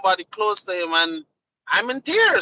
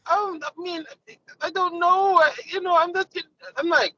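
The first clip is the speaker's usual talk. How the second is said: higher, halting mock sob in the wife's voice; mock tearful wife; 8 semitones higher than usual. Husband imitating his wife crying at a show, mock tearful and high. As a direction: imitate her teary stammer, half-playing it up. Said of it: "and she crying".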